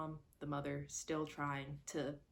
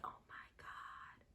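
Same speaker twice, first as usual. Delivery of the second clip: slow, stunned whisper; gasping in disbelief; shocked whisper; 17 dB quieter than usual. Retold or imitated. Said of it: "And it's like".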